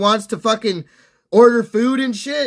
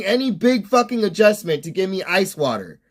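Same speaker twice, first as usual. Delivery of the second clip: ordinary talk, nothing marked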